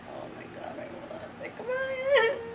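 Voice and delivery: breathy gasp, then a high whining cry; a half-laughing whimper at the memory; whimpering dread. Real, not acted. Dreading a painful biopsy.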